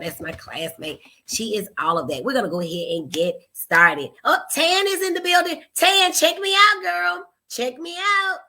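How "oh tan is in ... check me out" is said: higher and louder, calling out to a friend; excited greeting. Real, not acted. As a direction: show off to her cousin, thrilled. Greeting cousin, showing off necklace.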